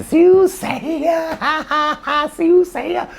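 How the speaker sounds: very high, slow squeal broken by laughter; high laughing squeal of "Siu say ya"; laughing hard. Retold or imitated. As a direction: gasp it out between fits of laughter. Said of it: "You're laughing so hard".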